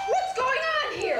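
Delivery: high-pitched, loud alarm; shrill-pitched shocked question on walking in; alarmed demand. Acted. Newcomer to the guilty group, alarmed demand. Walks in on the commotion.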